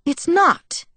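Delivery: high-pitched insistence; insistent. A narrator's reading. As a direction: insist with a sharp rise in pitch. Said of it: "Alice insisted".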